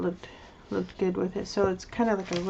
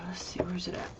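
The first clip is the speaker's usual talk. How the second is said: whispering; 9 dB quieter than usual. Real, not acted. Muttering to herself while searching.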